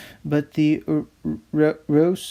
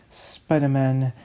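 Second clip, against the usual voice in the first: normal talking voice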